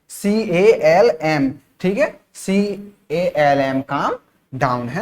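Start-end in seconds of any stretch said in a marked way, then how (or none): 0.1-1.5 सीएएलएम spelled slowly as C, A, L, M; spelled out letter by letter. Shown as a demonstration. Teacher spelling सीएएलएम slowly for students. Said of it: "calm down की spelling क्या है". 2.3-3.8 सीएएलएम spelled slowly as C, A, L, M; spelled out letter by letter. Shown as a demonstration. Teacher spelling सीएएलएम slowly for students. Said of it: "calm down की spelling क्या है".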